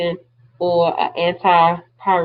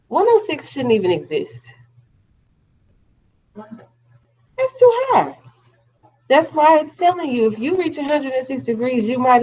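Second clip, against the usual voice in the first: high-pitched, aghast insistence; emphatic disbelief; 7 semitones higher than usual. Real, not acted. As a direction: stress how unthinkable it is, pitch riding high. Teacher aghast at extreme fever.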